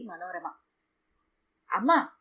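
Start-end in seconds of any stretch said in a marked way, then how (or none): 1.7-2.1 shouted call. A narrator's reading. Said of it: "గట్టిగా అరిచింది సుధామయ్యి".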